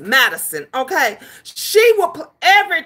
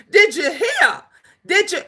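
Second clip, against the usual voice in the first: ordinary talk, nothing marked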